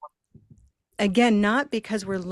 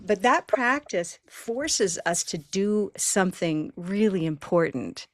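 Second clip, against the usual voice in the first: normal talking voice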